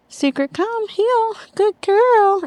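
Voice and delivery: high, sing-song command and praise; high command to puppy. Real, not acted. Trainer calling Secret to heel in a high, praising voice.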